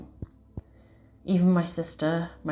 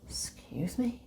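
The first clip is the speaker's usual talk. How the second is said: whispered aside; 10 dB quieter, slower than usual. Real, not acted.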